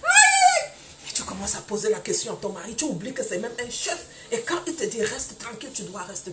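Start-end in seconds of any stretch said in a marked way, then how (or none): 0.0-0.7 a sharp high aïe, loud; loud exasperated cry. Real, not acted. Preacher crying out to the congregation, loud high aïe.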